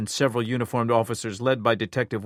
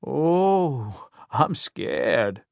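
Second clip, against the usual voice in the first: sarcastic, mock fear; a touch of taunting scorn; 3 semitones higher, much slower than usual. A narrator's reading. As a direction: sneer the fear away. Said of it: "Savage laughed".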